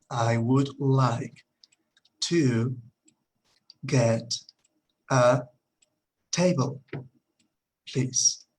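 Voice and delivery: word by word, with long gaps; very slow teaching delivery, each word set apart; slow pronunciation model. Shown as a demonstration. Teacher models asking for a table, slowly word by word. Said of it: "La pronunciación".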